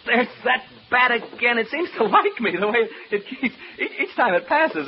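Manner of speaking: high, loud flustered alarm; startled alarm. Acted. Rattled man telling listeners the bat is back, startled alarm. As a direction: recoil as something flies at you.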